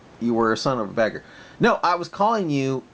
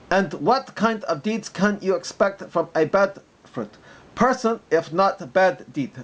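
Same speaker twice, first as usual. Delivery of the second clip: higher-pitched mock Arabic accent; 5 semitones higher than usual. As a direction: lecture in a put-on Arabic accent. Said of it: "Plus, I'll be doing a mock Arabic accent".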